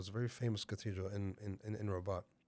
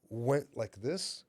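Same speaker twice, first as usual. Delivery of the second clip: ordinary delivery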